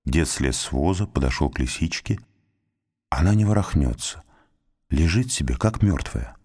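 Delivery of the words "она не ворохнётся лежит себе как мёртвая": usual speaking voice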